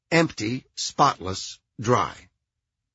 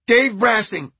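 loud, high nasal reply; nervous nasal caretaker; high-pitched nasal man; 9 semitones higher, 7 dB louder than usual. A narrator's reading. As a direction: answer police in a high, rattled voice. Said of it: "High-pitched, nasal voice".

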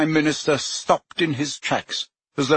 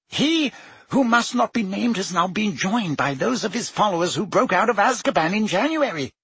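higher, fretful ministerial voice; anxious wizard official, pitched up; Fudge voice; 7 semitones higher than usual. A narrator's reading. Fudge warning the Prime Minister of war, higher and fretful.

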